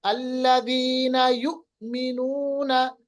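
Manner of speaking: अल्लज़ीना युमिनूना said slowly with a brief catch of the voice; jhatka pause demo. Shown as a demonstration. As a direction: stop the voice briefly, then move on. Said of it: "आवाज को थोड़ी देर के लिए रोकना". (झटके के साथ धीमा तिलावत)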